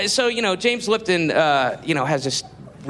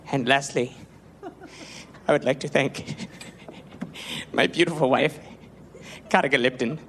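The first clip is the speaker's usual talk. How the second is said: mock tearful Lipton; 4 semitones lower than usual. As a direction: fake the weepy gratitude of a vain host. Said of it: "quoting, he's like".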